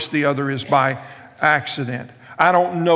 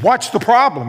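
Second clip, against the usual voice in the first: raised, higher-pitched teacher's demand; mock exasperation, pitched up and louder; exasperated teacher; 8 semitones higher, 7 dB louder than usual. Teacher confronting her giggling middle school class, exasperated.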